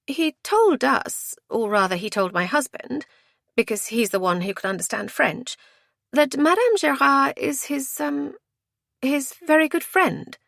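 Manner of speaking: high female character, picking words; high-pitched, careful explanation; hesitant explaining woman. A narrator's reading. Voices woman choosing words.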